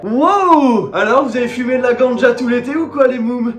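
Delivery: high, loud, slow hype-man welcome; over-the-top cheerful hello; hyped greeting. Acted. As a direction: greet the kids like a pumped-up host. Hyped back-to-school greeting to viewers.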